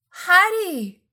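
loud, high, hammed-up greeting; exuberant call. A narrator's reading. Brother elbowing in to greet a friend, exuberant shout. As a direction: burst in calling out grandly. Fred bursts in, mocking greeting.

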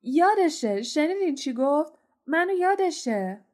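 high, loud, thrilled exclamation; delighted. Acted. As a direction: crow with delight that Harry remembers. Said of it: "با خوشحالی گفت". (فریاد شادمانه و بلند)

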